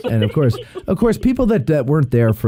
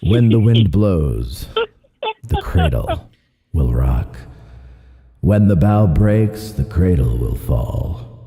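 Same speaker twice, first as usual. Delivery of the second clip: movie trailer guy; 6 semitones lower than usual. Pretend. Singing a lullaby as the movie trailer guy. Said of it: "You sound like the movie trailer guy".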